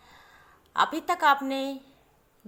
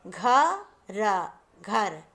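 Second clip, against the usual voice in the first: slow sounding out; 2 semitones lower, much slower than usual. Shown as a demonstration. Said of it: "जैसे".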